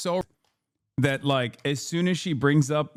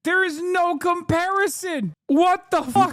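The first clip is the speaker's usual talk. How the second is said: very high, drawn-out protest; incredulous outburst. Real, not acted. Streamer reacting to an Israeli guest on the news, high incredulous outburst. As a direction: squeal it out in disbelief, way up high.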